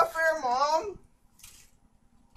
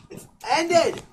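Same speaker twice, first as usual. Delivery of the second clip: slightly higher helium voice; 8 semitones higher than usual. Real, not acted. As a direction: squeakier repeat. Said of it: "Does my voice sound different".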